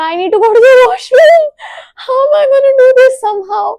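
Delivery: loud, high-pitched mock wailing; retold crying wail. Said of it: "I was crying after that".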